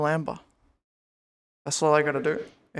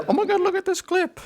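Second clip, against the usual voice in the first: a much higher, excited mock voice; mock excited friend; 14 semitones higher, 4 dB louder than usual. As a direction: play the friend gushing over a clip. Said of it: "and said".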